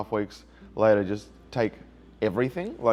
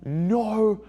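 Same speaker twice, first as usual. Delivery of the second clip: raised, defiant retold yell; 6 semitones higher than usual. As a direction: shout it back the way she yelled it. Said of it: "She starts yelling".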